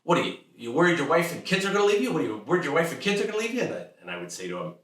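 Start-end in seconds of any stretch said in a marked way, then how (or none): 0.0-2.1 fast, higher, louder blurted dad; blurting father. Retold or imitated. Father blurting fear at worried son, fast high loud. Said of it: "blurt things out like". 2.1-3.6 very fast higher blurted repeat; blurting father. Retold or imitated. Father repeating blunt question, even faster. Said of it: "blurt things out like".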